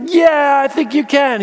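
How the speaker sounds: high, loud eager yes; crowd cheering. Retold or imitated. Crowd eagerly answering the walker, high and loud. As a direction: answer with a roar.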